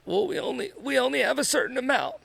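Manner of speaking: put-on child's voice, eager and sure; imitating little daughter. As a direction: pipe up like a small girl reciting a rule. Daughter Rosie voiced in story.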